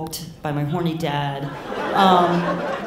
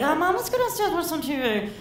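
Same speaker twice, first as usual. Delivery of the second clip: very high, put-on casual voice; mock-casual coaxing; 11 semitones higher, faster than usual. Kid steering stoned mom downstairs, mock-casual high coaxing. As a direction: coax in a much higher pitch, feigning ease.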